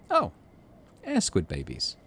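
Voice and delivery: usual speaking voice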